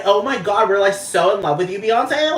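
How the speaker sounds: put-on high, loud, swooning Valley Girl gush; gushing Valley Girl accent. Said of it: "the Valley Girl accent just takes over".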